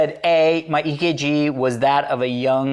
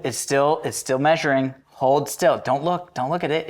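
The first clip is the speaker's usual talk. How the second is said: ordinary talk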